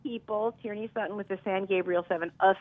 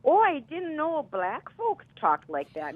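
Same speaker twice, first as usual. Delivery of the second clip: put-on high, slow, surprised Milwaukee accent; mock surprise in Milwaukee accent; 9 semitones higher, slower than usual. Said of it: "with that Milwaukee accent".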